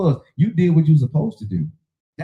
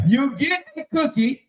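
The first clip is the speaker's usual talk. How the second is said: sing-song; 9 semitones higher, slower than usual. Real, not acted. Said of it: "I'm hating".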